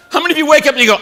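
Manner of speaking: ordinary delivery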